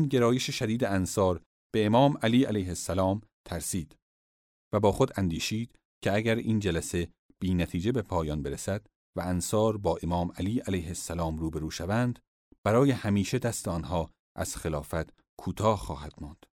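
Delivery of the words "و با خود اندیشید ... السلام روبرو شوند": ordinary delivery, nothing marked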